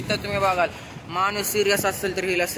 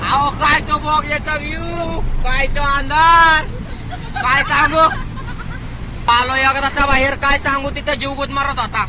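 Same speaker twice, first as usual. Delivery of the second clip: loud, high calls to hear the echo; shouting in the tunnel; 10 semitones higher, 11 dB louder than usual. Real, not acted. Vlogger shouting to viewers inside a tunnel, loud and high. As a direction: yell it out so it booms off the tunnel walls.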